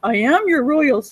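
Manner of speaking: mock servant. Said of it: "looking at them like".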